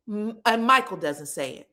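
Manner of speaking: normal talking voice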